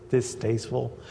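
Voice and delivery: ordinary talk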